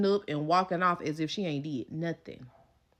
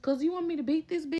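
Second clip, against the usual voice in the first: higher, aggressive cousin; ready to fight; 9 semitones higher than usual. Retold or imitated.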